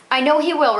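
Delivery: husband firmly contradicting his wife; with some spirit. A narrator's reading. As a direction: push back firmly. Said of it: "replied Mister Cosens with some spirit".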